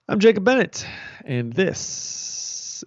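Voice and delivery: a hint of a Southern drawl in the intro; light Southern accent, slowing down. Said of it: "that accent is that you are attempting to do".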